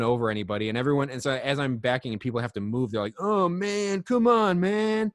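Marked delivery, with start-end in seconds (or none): 3.2-5.1 high, loud whiny protest from a hippie; put-upon hippie objecting to being moved; mock hippie complaint, pitched way up; complaining hippie.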